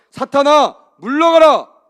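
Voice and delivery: very loud, high-pitched rebuke of Satan; commanding shout. Shown as a demonstration. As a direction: shout it out for the congregation to repeat. Leads congregation in rebuking Satan. (사탄을 쫓듯 크게 외치는 명령)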